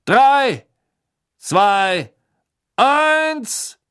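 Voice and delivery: high, booming announcer count; loud countdown. A narrator's reading. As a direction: call the start to the stadium. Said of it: "seine magisch verstärkte Stimme hallte".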